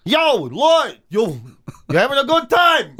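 hammed-up Boston American calling out; mock American accent. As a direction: put on a brash American street accent. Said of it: "It's me trying to be an American".

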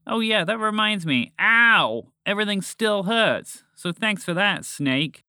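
high, hurt grumble with a loud yelp; complaining. A narrator's reading. Thorn-pricked frog to snake, complaining. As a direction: thank them sarcastically through complaint.

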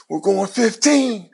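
high, drawn-out announcement; slow, high-pitched replayed excitement; retold excited announcement. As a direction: call out big news, imitating the moment. Announcing draft news to family, retold.

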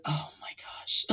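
whispered, slow "oh my gosh"; hushed awe; awed whisper. Retold or imitated. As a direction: breathe it out in amazement. Amazed by great singer.

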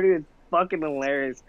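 ordinary talk

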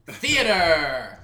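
very loud, drawn-out title; hammed-up theatrical header; grand announcement. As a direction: bellow it and stretch the ending.

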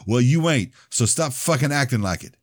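a narrated bully's loud sneer; tough guy. Acted. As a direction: talk big and hard to scare him. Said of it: "Trying to look all tough".

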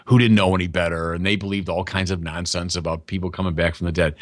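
mock condescension. Preacher imitating people who look down on pre-scientific folks, mildly condescending. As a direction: talk down in a low, dismissive mock-quote. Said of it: "That is pure condescension".

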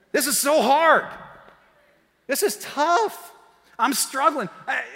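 high, loud pretend groaning complaint; hammed-up whiny struggle; mock struggling whine. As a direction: moan like it's all too much. Mock whining about hard year.

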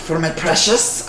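slow creature bargaining; Gollum. Acted. Goblin pricing his cloth for customer, Gollum voice. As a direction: linger possessively on the treasured cloth. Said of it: "I should do Gollum voice".

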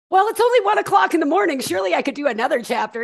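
high, fast and loud, laughing; giddy late-night bargaining with a grin; excited reader voice. Retold or imitated. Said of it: "be like".

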